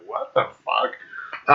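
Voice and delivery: hushed, drawn-out disbelief; baffled near-whisper. Acted. Onlooker stunned by Rob's new tattoos, hushed disbelief. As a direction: drop to a slow, airy whisper.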